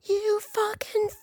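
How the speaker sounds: high, drawn-out mock screech; strained high-pitched yell, held back in volume; retold scream. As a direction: imitate her screaming, stretching the last word. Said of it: "She just screamed out".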